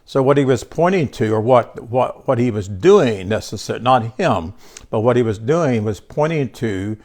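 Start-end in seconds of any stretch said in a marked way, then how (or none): none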